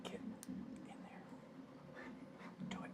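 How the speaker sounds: soft whispered coaxing. Real, not acted. Builder whispering encouragement to a pin. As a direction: hush it to the pin.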